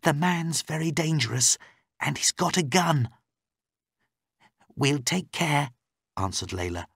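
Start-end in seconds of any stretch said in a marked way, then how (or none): 0.0-3.1 high, urgent warning; raised female voice, worried; anxious captive woman. Acted. Captive warns of danger. 4.7-5.7 high, lightly voiced female rescuer; slightly feminine character voice, reassuring; reassuring policewoman. Layla answers warning.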